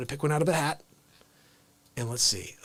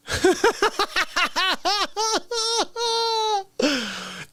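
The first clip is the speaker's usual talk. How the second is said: a high cackling burst of laughter; 13 semitones higher than usual. Real, not acted. Laughing hard at the dog clip, a high cackle.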